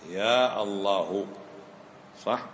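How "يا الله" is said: يا الله said very slowly, يا held long; slow spelled-out call. Shown as a demonstration. Teacher showing students the original form, very slow. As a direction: stretch يا and take الله slowly. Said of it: "أصلها اللهم، يعني يا الله".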